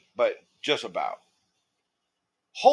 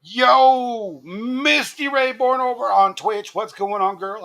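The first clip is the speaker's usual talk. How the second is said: loud, much higher, slow call-out; excited greeting; 12 semitones higher, 5 dB louder, slower than usual. Real, not acted. Streamer welcoming Misty Ray Born to the stream, loud and excited. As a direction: call out to a friend who just arrived.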